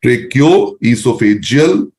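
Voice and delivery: very slow, each syllable of Tracheoesophageal laid out; slow teaching drill. Shown as a demonstration. Teacher drills students slowly on the full form of TEP. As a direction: spell the term out slowly for students to repeat. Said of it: "when I say speak, speak also".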